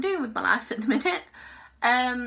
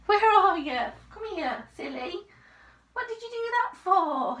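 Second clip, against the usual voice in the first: high-pitched pet talk; raised, sing-song questions to a pet; talking to a dog; 9 semitones higher than usual. Real, not acted. Cooing to the dog.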